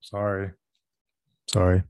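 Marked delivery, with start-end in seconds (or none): 1.5-1.8 deliberately loud repeat; louder apology. Real, not acted. Husband told he is whispering, apologising loudly. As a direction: say it much louder, to be heard.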